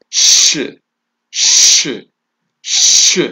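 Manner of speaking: ش as a long hissed unvoiced shhh, repeated; isolated sh sound. Shown as a demonstration. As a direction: say ش alone as a crisp voiceless sh. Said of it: "حرف الشين في وسط الكلمة مع السكون".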